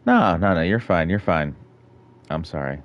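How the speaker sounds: ordinary delivery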